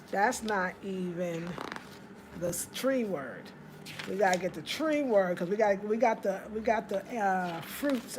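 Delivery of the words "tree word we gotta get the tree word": ordinary talk, nothing marked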